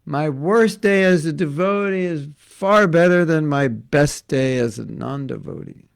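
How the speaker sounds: quoting a new devotee's joy with a loud exultant voice; ecstatic quote. Retold or imitated. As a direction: declare it like the happiest man alive. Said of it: "and he said".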